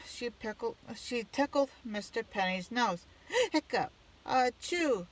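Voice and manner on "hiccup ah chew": high hiccup into a big ah-chew sneeze; hammed-up sneeze after a hiccup; hiccup then sneeze. Miss Gates tickles his nose, hiccup and sneeze. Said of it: "sneezed Mister Penny".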